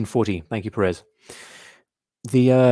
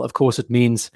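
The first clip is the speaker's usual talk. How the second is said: normal talking voice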